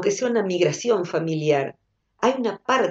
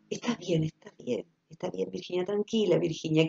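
soft, much quieter reassurance; soothing self-talk; 7 dB quieter than usual. Retold or imitated. Patient before surgery calming herself, softly soothing. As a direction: hush yourself gently before the operation. Self-comfort in frightening moment.

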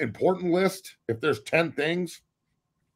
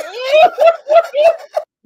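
shrieking burst of laughter; loud high laugh; 13 dB louder than usual. Real, not acted. Laughing hard at his own mock rant.

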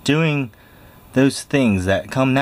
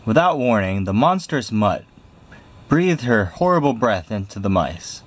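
plain reading voice

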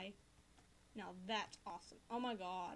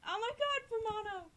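much higher and louder squeal; fangirl squeal; 11 semitones higher, 8 dB louder than usual. Real, not acted. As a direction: squeal over your favourite character. Fangirling over character.

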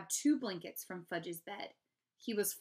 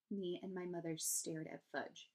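usual speaking voice

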